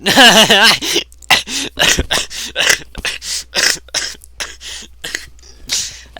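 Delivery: loud, high burst of laughter trailing into wheezes; uncontrolled laughing fit. Real, not acted. Reader cracking up at the game, helpless laughter.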